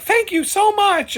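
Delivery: high, slow, sugary thank you; sweet old lady. Retold or imitated. Old lady thanking him for paying, high slow sweet voice. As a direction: play the grateful old lady.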